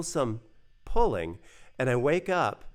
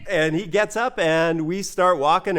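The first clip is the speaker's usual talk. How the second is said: normal talking voice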